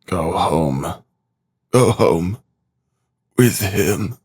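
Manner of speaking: weary, faltering short phrases; understated broken voice, halting between phrases. A narrator's reading. Sick man sending mother and sister home, mildly broken voice. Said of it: "he said in a broken voice".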